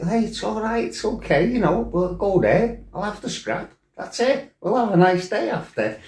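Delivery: hammed-up casual calming; mock cheery reassurance. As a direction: brush it off sweetly. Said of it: "I'm like".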